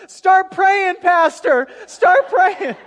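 high, very loud scream for help; panicked scream. Retold or imitated. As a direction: scream it out like a terrified plane passenger. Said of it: "she screams out".

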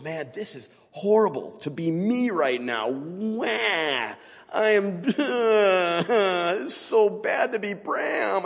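high, drawn-out mock whining with a laugh; whiny self-pity. Recruit mocking his own misery in training, high drawn-out whine. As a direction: moan like a sorry recruit feeling sorry for himself. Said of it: "I'm over there just going".

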